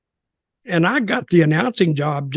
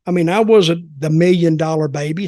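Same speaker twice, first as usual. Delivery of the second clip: normal talking voice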